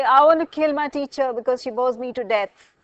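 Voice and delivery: loud, acted angry complaint; mock-angry outburst. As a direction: play at being furious, at full volume. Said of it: "If you're really angry with somebody".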